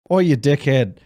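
mock Australian. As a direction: call out to the dog in an Australian accent. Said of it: "I forgot he's Australian".

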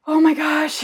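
high, loud "oh my gosh"; shocked at seeing the head; startled gasp. Retold or imitated.

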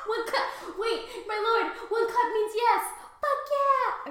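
a giddy reply bubbling with laughter; excited laughing. Acted. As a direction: gush it out delighted. Laughing playful seal talk.

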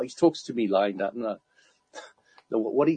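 ordinary delivery

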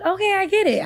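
high-pitched sudden acceptance; retold realization. Retelling how she came round to her voice, high-pitched realization. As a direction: concede it in a lifted voice. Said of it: "So I just be like".

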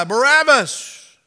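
high, loud, drawn-out roar of a mob; crowd shouting. Acted. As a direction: shout it back like a whole crowd. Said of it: "the crowd shouted back their reply".

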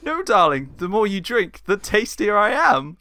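high-pitched, coquettish stage mother; flirty pantomime dame. Acted. Plays flirty Mother Jamalio.

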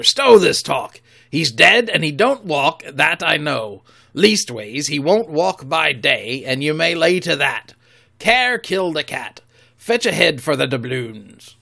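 loud rough pirate leader; commanding Silver. Acted. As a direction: bark the crew back to work. Silver rallies men.

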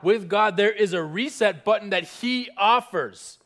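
proclaiming loudly; full-voiced preacher declaration. Shown as a demonstration. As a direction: lift it and declare. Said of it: "someone say".

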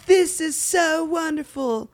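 high, slow mock English accent, dropping the TH. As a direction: lampoon a posh English thank-you. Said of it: "She was like".

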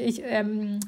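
normal talking voice

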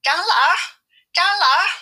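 high, loud call from outside. Acted. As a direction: call him from afar, sweetly. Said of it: "在外面叫道".

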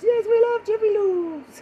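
very high mock Eastern European voice; Eastern European accent. Foreign host praising American chains, Eastern European accent. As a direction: falsetto Eastern European fan. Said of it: "I sound like I'm some Eastern European country".

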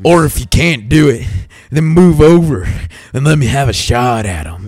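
loud, hammed-up southern accent. Harry muscling in on the lovers, put-on southern accent. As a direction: play it as a forceful Southerner. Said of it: "I'm gonna give him a southern accent".

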